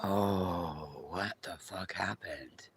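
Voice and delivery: hushed, baffled whisper; whispered what the fuck. Real, not acted. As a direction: whisper it in disbelief. Baffled aside.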